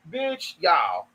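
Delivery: usual speaking voice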